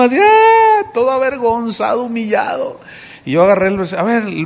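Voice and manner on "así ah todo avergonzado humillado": mock sobbing. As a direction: act out his ashamed crying. Imitates the man crying. (sollozo imitado)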